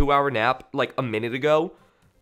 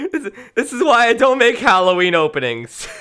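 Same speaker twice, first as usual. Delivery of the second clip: higher, laughing as the words come out; 5 semitones higher, 4 dB louder than usual. Real, not acted. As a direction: try to say it through real laughter. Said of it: "can't listen to that opening without actually laughing".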